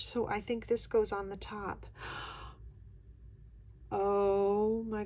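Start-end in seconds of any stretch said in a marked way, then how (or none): 1.9-2.7 soft gasp. Real, not acted. Unboxing, sees new item.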